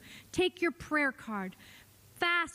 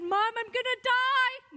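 higher pleading cry, echoing her son; imitated panicked son; 10 semitones higher than usual. Mother imitating her overdosing son begging her, panicked. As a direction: plead in a frightened young voice. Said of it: "He said".

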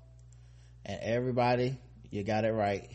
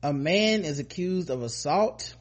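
news read; 3 semitones higher than usual. Acted. Reads the news story.

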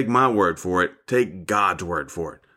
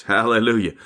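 ordinary delivery

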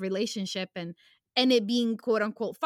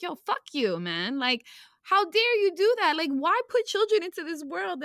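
raised, higher-pitched outrage; angry; 8 semitones higher, 4 dB louder than usual. Real, not acted. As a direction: demand answers in real anger, voice climbing. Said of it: "you hear it in my voice".

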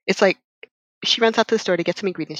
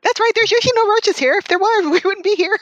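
high, louder silly voice for the guy; high mock pub guy; 11 semitones higher, 3 dB louder than usual. Retelling the pub guy, hammed-up high voice. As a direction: play him up as a cheerful dope. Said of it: "pub guy voiced".

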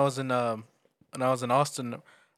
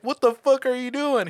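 high-pitched passenger's protest; retold alarmed disbelief; 10 semitones higher than usual. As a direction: demand an explanation in mock panic. Retells scared passenger protesting.

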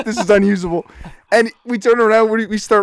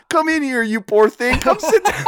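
put-on higher sweet old-lady voice; 6 semitones higher than usual. Kindly old lady welcoming a tired kid inside, higher and sweet. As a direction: coo it like a kindly grandma. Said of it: "And she goes".

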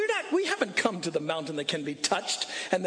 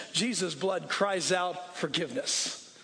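ordinary talk, nothing marked

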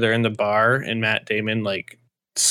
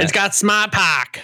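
slow, higher, r-less Boston accent; 7 semitones higher, slower than usual. Shown as a demonstration. Friend offers well-known phrase in Boston accent. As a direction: drop the r's like a Bostonian. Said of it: "I find it helps to say, like, a really well-known, like, phrase".

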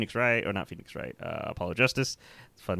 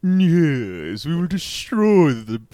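snooty villain voice, slow and haughty; snooty Megatron voice; 6 semitones higher, 5 dB louder, much slower than usual. Retold or imitated. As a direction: play a haughty robot overlord. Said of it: "snooty voice of Megatron".

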